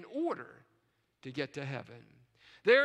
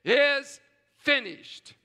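loud, slow, every word weighted; emphatic slow declaration; 3 semitones higher, 7 dB louder, much slower than usual. Retold or imitated. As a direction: proclaim it like the final word. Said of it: "he said emphatically".

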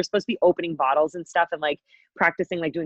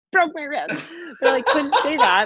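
high-pitched, laughing through the words; laughing confession; 9 semitones higher than usual. Real, not acted. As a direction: crack up as it comes out. Cracks up at the real story.

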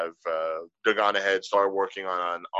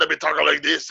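louder, higher, rougher Scottish burr; gravelly Scottish accent; 5 semitones higher, 4 dB louder than usual. Shown as a demonstration. Showing the Irish turning tipsy, gravelly Scottish accent. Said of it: "like, a little more gravelly".

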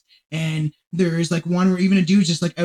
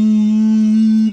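loud, higher sustained trumpet hum; imitated trumpet drone; 5 semitones higher, 8 dB louder than usual. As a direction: hum one long loud blaring note. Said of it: "like, brass trumpets".

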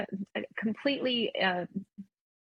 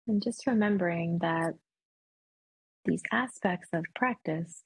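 meditation voice. Acted. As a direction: ease them gently back into the room. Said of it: "a great meditation voice too".

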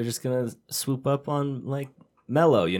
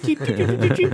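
rising, louder imitated electronic toots; computer beeps; 5 dB louder than usual. Retelling a sketch, imitating the machine's beeps climbing higher. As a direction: beep like an old green-screen terminal.

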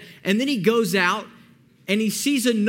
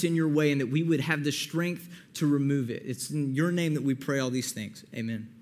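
prayer; 4 semitones lower than usual. Real, not acted. Opens the talk in prayer.

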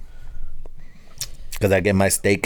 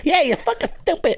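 much higher, put-on arguing voice; mock Mexican argument. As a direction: play a family quarrel for laughs. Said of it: "what do we sound like".

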